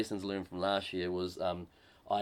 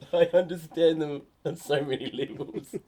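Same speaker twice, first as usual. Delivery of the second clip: laughing; 7 semitones higher, 6 dB louder than usual. Real, not acted. Laughing at own joke.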